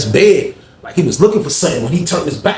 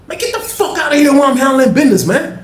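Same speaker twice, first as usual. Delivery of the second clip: mock angry yelling; 12 semitones higher than usual. Cellmate yelling at his roommate to get out, high and hammed-up. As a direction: bark him out of the room, laughing at him.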